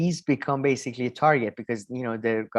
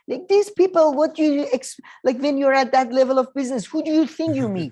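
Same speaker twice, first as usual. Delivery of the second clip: much higher, louder exasperated disbelief; incredulous rant; 11 semitones higher, 5 dB louder than usual. Real, not acted. Ranting to his co-host about oligarch friendships, incredulous and high. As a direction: pitch it up in disbelief at the idea.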